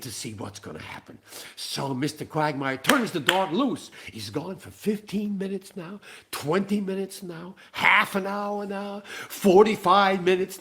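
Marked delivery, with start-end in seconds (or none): none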